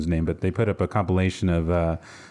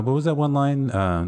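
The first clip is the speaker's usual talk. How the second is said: usual speaking voice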